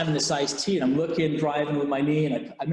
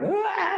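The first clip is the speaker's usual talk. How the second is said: anguished cry. Retold or imitated. Breaking down crying at debrief.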